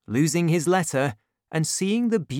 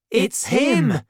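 high, louder sudden cry; cried out; 8 semitones higher, 5 dB louder than usual. A narrator's reading. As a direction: spot the man and cry out. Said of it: "they both cried at the same time".

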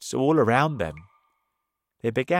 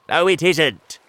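loud, higher contradiction; crying out in protest; 6 semitones higher, 10 dB louder than usual. A narrator's reading. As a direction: contradict loudly and miserably. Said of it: "cried the earthworm".

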